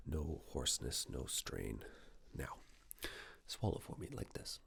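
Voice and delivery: ordinary talk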